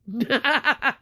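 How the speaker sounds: high, short burst of laughter; bitter laugh. Real, not acted. Woman who says nobody cares, bitter laugh. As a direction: laugh bitterly at your own lot.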